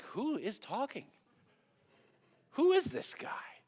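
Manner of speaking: high, drawn-out disbelief; incredulous onlookers. Acted. Soldiers overhearing David's bold talk, incredulous. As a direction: imitate baffled bystanders, pitch leaping up. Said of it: "You wonder if people hearing".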